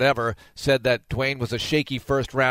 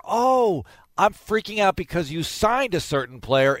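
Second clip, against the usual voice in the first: higher, hammed-up worried fan; mock fretting fan; 5 semitones higher than usual. Host mocking fans panicking over a signing, mock worried fan. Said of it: "It's never".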